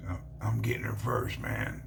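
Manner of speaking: hushed, under the breath; secretive whispered aside. Retold or imitated. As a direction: lean in and whisper it.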